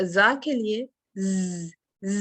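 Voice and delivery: ز held with a buzz. Shown as a demonstration. Teacher showing the buzz of زا: humming z. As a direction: let the ز buzz between the teeth. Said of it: "buzzing sound ہے".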